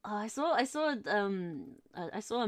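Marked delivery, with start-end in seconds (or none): none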